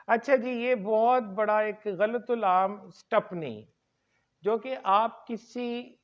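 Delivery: ordinary talk, nothing marked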